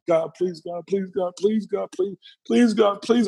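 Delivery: urgent, repeated pleading in retelling; desperate praying. Retold or imitated. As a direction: beg God over and over, pitch creeping up. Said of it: "I'm praying".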